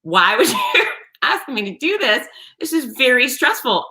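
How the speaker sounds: mock exasperated complaint, rising in pitch; mock cursing. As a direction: curse a friend half-jokingly, with a laugh midway. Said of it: "I started cursing at Vince".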